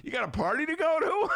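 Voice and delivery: put-on high, loud, impatient drunk; drunk guy shouting. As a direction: yell like a pushy drunk. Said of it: "that's my drunk guy imitation".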